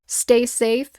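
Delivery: plain reading voice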